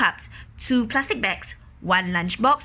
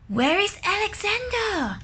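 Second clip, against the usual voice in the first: worried duck call; 11 semitones higher than usual. Acted. Duck family searching.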